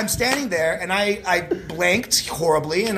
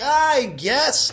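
high-pitched, hesitant retold reply; reluctant agreement; 9 semitones higher than usual. Would-be leaver answering at audition door, reluctant. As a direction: agree unwillingly, voice pushed up high.

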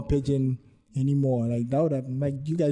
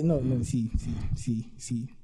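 ordinary talk, nothing marked

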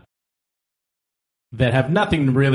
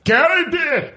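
Scottish shout of Gordy. Retold or imitated. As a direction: yell "Gordy!" like a mock Scotsman. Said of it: "him saying Gordy".

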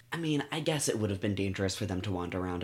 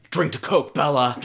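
loud, higher-pitched mock command; Edward's barked order; 5 semitones higher, 6 dB louder than usual. Host mimicking Edward bossing Bella about her drink, mock barked order. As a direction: bark it as a hammed-up order.

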